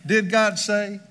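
sly tempter voice, lightly put on; lightly voiced sneaky devil, slipping the question in; mock devil voice. Scene: preacher echoes the serpent's doubt, mock devilish. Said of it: "heard that somewhere else before".

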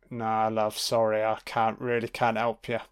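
low, soft, offhand brush-off; Jesus. Acted. Jesus declining a woman's plea, low and offhand. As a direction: turn them down gently, low and casual. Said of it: "He's like".

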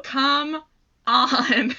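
excited. Real, not acted. Gushing over a favourite pair.

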